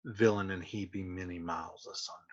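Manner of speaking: a quiet stage aside to the crowd; under her breath. Acted. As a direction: say it to yourself, almost unheard. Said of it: "off to herself under her breath".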